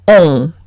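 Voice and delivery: On said low as a French nasal vowel, no n sound. Shown as a demonstration. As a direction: model the nasal on. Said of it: "se lee on".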